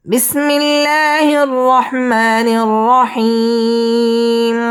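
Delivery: slow, high chanted basmala; Quran recitation. Shown as a demonstration. Teacher reciting before the lesson, slow chant. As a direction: draw it out like a reciter.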